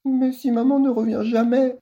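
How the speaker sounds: high small voice, lisping, near tears; a little kid lisping with a sob; lisping child. Acted. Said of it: "zozote Sydney, un sanglot dans la voix".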